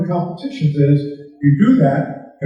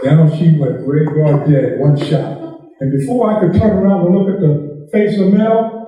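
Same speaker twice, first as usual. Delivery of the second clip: usual speaking voice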